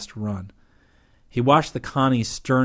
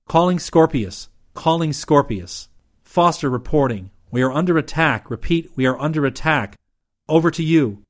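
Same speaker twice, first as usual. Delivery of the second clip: higher, louder calling out; urgent radio call; 5 semitones higher, 6 dB louder than usual. Acted. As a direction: raise the ship on the radio, firm and loud. Radioing an alarm under attack.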